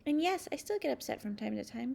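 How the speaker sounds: ordinary delivery, nothing marked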